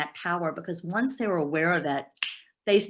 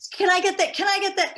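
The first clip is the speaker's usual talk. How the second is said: high-pitched, louder mimicry of customers; impatient customers pestering, pitched way up; pushy customers; 13 semitones higher, 4 dB louder than usual. Customers coming at a restaurant owner, high pushy mimicry.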